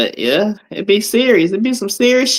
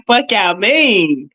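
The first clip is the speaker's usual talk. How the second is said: slow mock repeat; 2 semitones higher, much slower than usual. Repeating the clip's slurred line.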